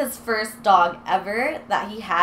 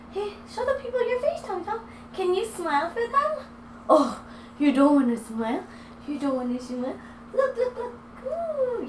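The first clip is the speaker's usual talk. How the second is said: sing-song pet talk, softer; 10 semitones higher, 5 dB quieter than usual. Real, not acted. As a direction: coo at the puppy to get him to look up. Coaxing the dog for camera.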